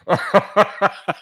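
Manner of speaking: short high laugh. Real, not acted. Laughing after drinking shot.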